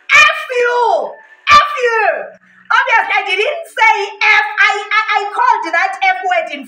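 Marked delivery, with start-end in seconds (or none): 0.1-2.0 shouted retort, pitched way up; loud yelled insult. Retold or imitated. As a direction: re-enact yelling an insult. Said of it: "I said that very loudly".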